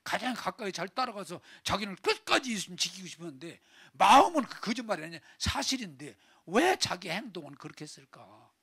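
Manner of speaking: ordinary talk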